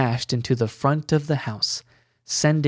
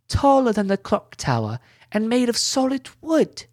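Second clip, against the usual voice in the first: put-on excited boy; 10 semitones higher than usual. A narrator's reading. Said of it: "Billy almost sounded excited".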